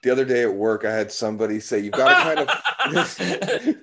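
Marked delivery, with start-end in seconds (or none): 1.8-3.8 laughing. Retold or imitated. Quoting coworker, breaks laughing.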